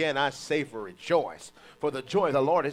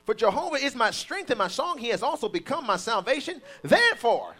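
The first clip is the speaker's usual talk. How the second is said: high, loud preaching, stretched out; full-force preaching; 12 semitones higher, 7 dB louder than usual. Acted. Preacher urging the congregation, at full force. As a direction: preach it out to the church at full power.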